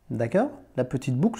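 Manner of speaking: usual speaking voice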